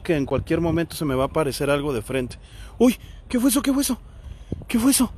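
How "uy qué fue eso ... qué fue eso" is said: voz aguda de susto repentino; sobresalto. Real, not acted. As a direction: pregunta alarmada, buscando el ruido. Susto por un ruido en panteón. (asustado de verdad, repitiendo dónde más agudo)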